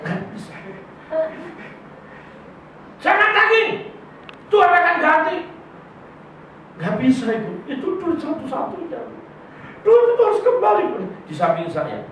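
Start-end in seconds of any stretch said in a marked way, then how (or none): 3.0-5.4 much higher, insistent command; hammed-up high pastor ordering him to forgive; mock pushy pastor. Pastor pressing the man. 9.9-10.8 much higher, insistent command; hammed-up high pastor ordering him to forgive; mock pushy pastor. Pastor pressing the man.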